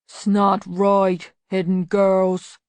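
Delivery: slow, loud, lumbering big troll-man voice; a hulking simpleton, slow and loud; deep voice. A narrator's reading. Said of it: "said a deep voice".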